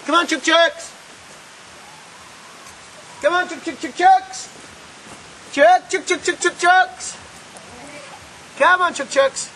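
high sing-song call to the hens; calling chickens. Real, not acted. Calling his chickens to come.

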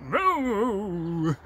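a mild mock cow moo; lightly voiced moo. Cow in bed mooing at the robber, lightly voiced.

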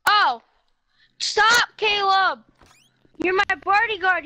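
ordinary talk

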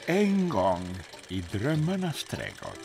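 usual speaking voice

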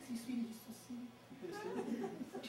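high, thin creeper song through a laugh; lightly sung brown creeper mnemonic, tinny E sounds; high tinny bird imitation. Shown as a demonstration. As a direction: chirp it like a tiny bird, slowing at the end. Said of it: "it's just like".

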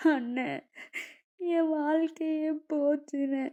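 tearful, slow and high plea; crying sister. Acted. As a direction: sob out the words to her brother. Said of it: "மலர் அழுதுட்டே ஓடி வந்து". (அழுதுகொண்டே கெஞ்சும் குரல்)

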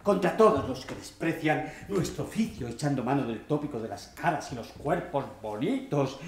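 ordinary talk, nothing marked

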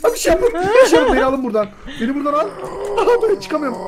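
high, fast panicky yelps through laughter; panicked laughing. Real, not acted. Monster approaching, panicking laughter.

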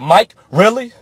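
hammed-up disapproving lecture; mock scolding critic. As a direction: play a scandalized fan wagging a finger. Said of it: "I know what you're thinking".

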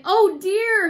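a mild storybook wail; crying. A narrator's reading. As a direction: cry it out in dismay. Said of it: "he cried".

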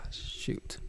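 unvoiced whispered curse. Real, not acted. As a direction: whisper it under your breath. Muttered after sighing.

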